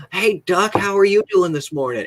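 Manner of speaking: high, chirpy hello to an animal; sing-song, high-pitched retold greeting; excited greeting. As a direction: greet a duck with over-the-top delight. Acts out greeting a duck.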